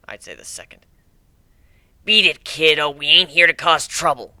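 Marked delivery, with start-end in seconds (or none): 2.0-4.3 a touch of scowling toughness. Acted. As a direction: tell the kid to beat it, gruff. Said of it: "Gilda scowled".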